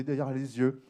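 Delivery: normal talking voice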